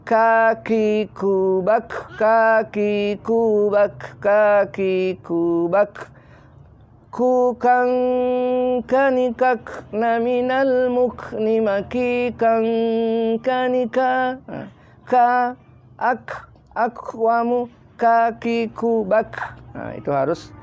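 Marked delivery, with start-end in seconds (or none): 0.1-14.4 slow chanted "kaki kubak", K released with air; hissed kaf drill. Shown as a demonstration. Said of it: "Harus ada desisnya". 15.1-19.6 "ak" ending in a puff of air; hissed kaf. Shown as a demonstration. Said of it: "keluar mendesisnya".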